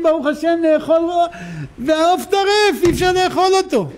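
put-on high, drawn-out wail of a little girl; mock tearful child. Little girl explains the ruined Sabbath chicken, mock tearful wail. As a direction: whine it out slowly and high, as if crying. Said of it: "היא לא יכולה".

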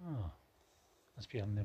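ordinary talk

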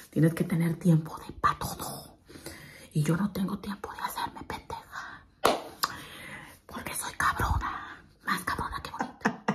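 breathy hushed whisper. Real, not acted. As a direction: keep it secret, whisper. Hushed in bathroom.

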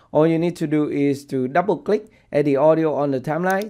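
usual speaking voice

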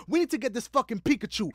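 usual speaking voice

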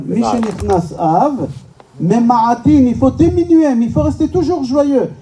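normal talking voice

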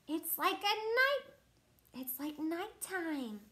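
high, loud cry of alarm. A narrator's reading. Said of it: "cried the sea urchin".

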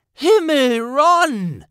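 very high, loud exasperated cry; exasperated sigh. Acted. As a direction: groan at his crudeness. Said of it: "seufzte Hermine". (genervter, lauter Ausruf)

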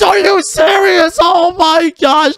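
high, slow, shouted disbelief; outraged shout. Acted. Builder shouting at TV woman after she blew up his house, outraged. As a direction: yell it out as the house explodes.

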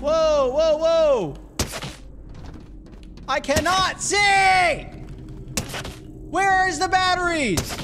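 desperate to find light; panicked shouting. Real, not acted. Player blinded in the dark, panicked shouting. Cannot see, searching batteries.

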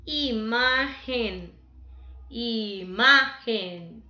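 Imagen slowly split, MA punched loud; slow teacher's Imagen, weight on MA; stress on MA. Shown as a demonstration. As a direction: stretch Imagen out and hit the MA. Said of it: "Ma viene en la segunda".

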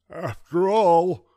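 put-on high, slow, loud gummy old man; mumbling toothless grandpa. A narrator's reading. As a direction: mumble it through gums. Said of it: "he mumbled".

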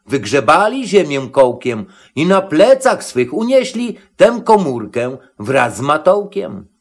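plain reading voice

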